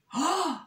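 high, sharp acted gasp; sudden storybook gasp; alarmed gasp. A narrator's reading. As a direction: gasp in mock fright. Little Bear's sudden alarm.

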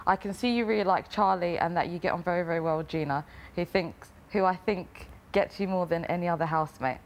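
usual speaking voice

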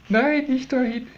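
high startled protest; high alarmed warning. Real, not acted. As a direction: squeak out a quick no. Bird heading to her shirt.

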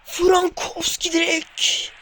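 slow, soaring shout of the player's name; drawn-out excited shout. Real, not acted. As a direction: hold each syllable like a stadium commentator's roar. Goal chance in football game.

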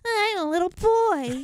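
put-on high, drawn-out little-boy voice; Jack. Co-host imitates a game's boy, little boy voice. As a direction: stretch it like a small boy. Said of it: "the little boy voice they did".

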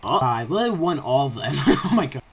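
normal talking voice

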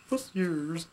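normal talking voice

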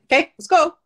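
rally a friend to get moving; pep talk. Retold or imitated. Cheering on a struggling friend.

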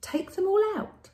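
high sage voice; a sly old sage's advice; wise old sage. Acted.

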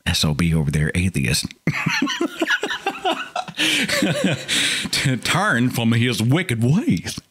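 muttering a sanctimonious prayer, breaking into laughter; mock pious prayer. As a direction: pray piously for the sinner, then crack up. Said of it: "they're thinking".

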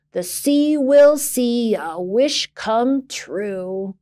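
put-on gruff old sailor. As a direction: lay down the rhyme like an old sea captain. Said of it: "stomping down the steps".

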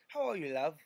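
lightly put-on London accent. Shown as a demonstration. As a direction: ask it the London way. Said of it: "in the London accent".

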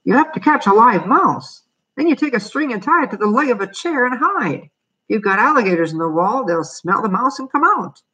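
high, singsong exterminator giving instructions; lilting high-pitched character voice. A narrator's reading. Exterminator figure explains the trap.

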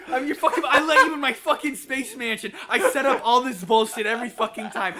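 loud, high aggrieved rant; high-pitched heated outburst; indignant shouting. Real, not acted. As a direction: yell your list of grievances. Yelling back in argument.

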